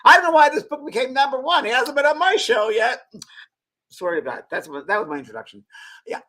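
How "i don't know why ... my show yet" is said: much higher and louder, mock grand host; mock Oprah. Retelling Oprah's snub on air, loud high mock host voice. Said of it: "She goes".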